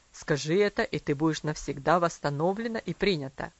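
stern father. A narrator's reading. Father dictating apology.

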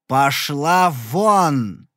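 very slow, loud, drawn-out yell. A narrator's reading. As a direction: throw her out with a roar. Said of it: "завопил я". (завопил, очень медленно и громко)

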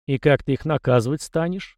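ordinary delivery, nothing marked

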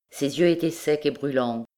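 plain reading voice

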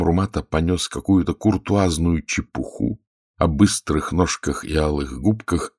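usual speaking voice

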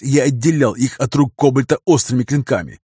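ordinary delivery, nothing marked

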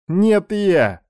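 high, mocking snide retort; venomous sneer. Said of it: "ядовито оскалялся Рома".

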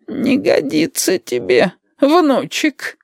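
put-on high, drawn-out old granny voice; high-pitched granny from her sickbed; sepulchral grandma. A narrator's reading. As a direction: croon it slowly as the old grandmother. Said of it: "Глухо, как из гроба, прозвучал бабулин голос".

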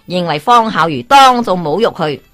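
usual speaking voice

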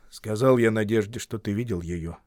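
ordinary delivery

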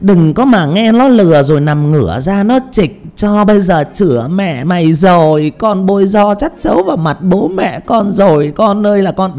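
put-on old mother wailing at her pregnant daughter, high and loud; scolding old woman. Shown as a demonstration. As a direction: scold like a shrill village granny lamenting. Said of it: "Cái giọng của bà già nó lại khác một chút".

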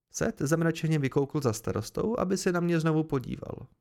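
usual speaking voice

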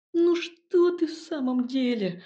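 high, drawn-out imploring whine; pleading. A narrator's reading. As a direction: beg her, stretching it out. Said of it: "взмолился Илья". (умоляюще, высоко и протяжно)

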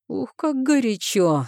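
high, slow, relishing exclamation. A narrator's reading. Zharov mockingly delights in the heroine's anger, high and gleeful. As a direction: savour it with glee. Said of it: "с восторгом повысил голос Жаров".

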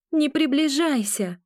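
frightened warning. A narrator's reading. Heroine warns off darkness.

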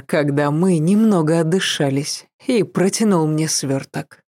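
plain reading voice

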